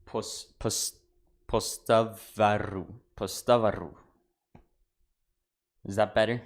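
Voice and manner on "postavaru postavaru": postavaru, every vowel sounded, a clear A before the R; careful pronunciation. Shown as a demonstration. Told not to skip the last A, sounding out postavaru slowly. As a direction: spell out postavaru slowly and a bit louder. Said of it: "why are we skipping the last A?".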